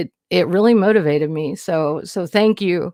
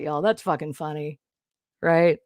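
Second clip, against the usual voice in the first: normal talking voice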